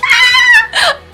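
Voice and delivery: high loud squealing scream; fan scream. Retold or imitated. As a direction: shriek with delight at the confession. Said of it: "I was screaming".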